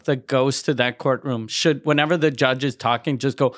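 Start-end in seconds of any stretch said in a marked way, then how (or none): none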